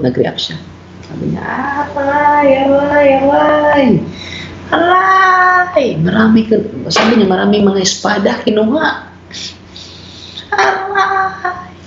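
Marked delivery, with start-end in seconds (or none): 1.9-5.9 high pained cries of aray; retold cries of pain. Man crying out during deliverance prayer, high pained yelps. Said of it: "Sumigaw siya". 10.5-11.9 sudden high yelp of aray; retold cry of pain. Man crying out as curses break, high yelp. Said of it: "Sumigaw siya".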